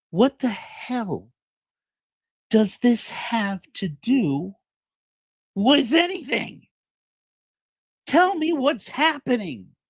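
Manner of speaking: high, slow, pleading; desperate Truman. Acted. Truman begging his wife to explain, high slow plea. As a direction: drag every word out in disbelief. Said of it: "he says".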